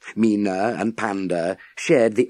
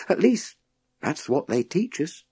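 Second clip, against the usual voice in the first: put-on raised young voice, thinking aloud; higher boy's voice; 10 semitones higher than usual. A narrator's reading. Boy tells the professor his theory in a raised young voice. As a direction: work the idea out as you speak.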